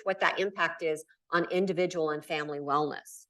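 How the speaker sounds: ordinary talk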